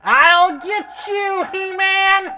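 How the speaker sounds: high, echoing villain voice through a microphone; shrill cartoon villain snarl into an echo mic; Skeletor voice. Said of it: "famous for his Skeletor voice".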